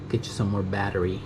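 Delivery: ordinary talk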